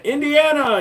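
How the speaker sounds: high, loud shout of the name; hollered call-out. Acted. Streamer calls out a viewer's home state, high holler.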